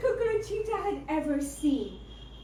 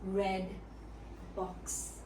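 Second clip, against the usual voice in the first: hushed reveal; 7 semitones lower, 8 dB quieter, slower than usual. A narrator's reading. Dramatic slow reveal.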